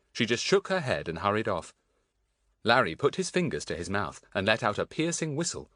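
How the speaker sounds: ordinary delivery, nothing marked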